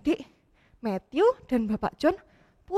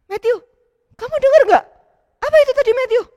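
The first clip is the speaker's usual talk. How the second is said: raised, alarmed question; startled father; 9 semitones higher, 6 dB louder, faster than usual. Acted. Papa hearing the shouting outside, asking Matthew alarmed. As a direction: hear a shout and ask your son.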